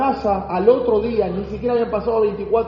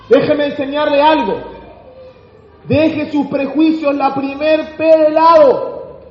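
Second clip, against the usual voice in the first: louder and higher, pounding the point home; full-force preaching; 6 semitones higher, 5 dB louder than usual. Acted. Preacher warning the church about prejudice, forceful and loud. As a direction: preach it hard to the congregation.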